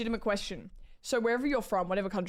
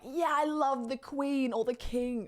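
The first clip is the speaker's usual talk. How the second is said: higher, drawn-out gushing fan voice; mock royal fanatic; 5 semitones higher, slower than usual. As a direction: gush slowly like an over-keen royalist. Mimics an imagined royal fanatic.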